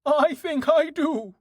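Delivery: very high, slow horse voice; high-pitched animal character voice; musing horse. A narrator's reading. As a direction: ponder as a horse, high and drawn out. Horse Dust thinking to himself.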